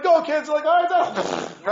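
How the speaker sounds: high, loud shout calling after them; shouting ahead. Retold or imitated. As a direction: yell it across the terminal. Father shouting to running kids.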